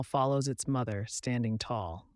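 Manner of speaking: ordinary delivery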